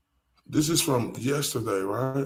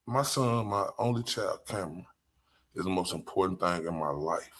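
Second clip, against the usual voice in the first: sincere vow; 5 semitones lower, 8 dB quieter than usual. Real, not acted. Serious vow about her son.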